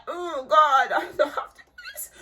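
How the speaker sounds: high, laughing exclamation; a real laugh breaking through. Real, not acted. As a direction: laugh it off in disbelief. Laughing at living alone.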